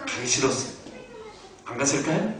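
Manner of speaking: ordinary talk, nothing marked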